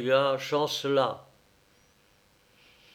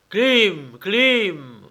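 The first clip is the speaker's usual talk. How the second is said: high, fading echoed call; 7 semitones higher, 6 dB louder, much slower than usual. Acted. The forest echo answering, high faraway call. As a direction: let the name ring back from the forest. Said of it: "répondit l'écho".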